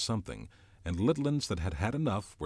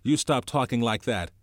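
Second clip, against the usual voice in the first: higher, louder scared rebuke; scared scolding; 5 semitones higher, 8 dB louder than usual. Acted. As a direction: snap at him out of fear.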